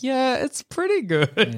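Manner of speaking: a pained, forced smile, high and slow. Retold or imitated. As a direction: force out a brave compliment, slowly. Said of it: "more and more pained smile".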